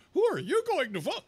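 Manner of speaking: high mocking falsetto; campaign texter. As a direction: mimic an eager canvasser squeakily. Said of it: "works for Beto O'Rourke and wanted to know".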